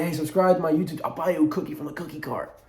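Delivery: put-on high, quick kid sales pitch; annoying kid. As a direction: rattle it off like a pestering little kid. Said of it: "being that annoying little nuisance of a kid".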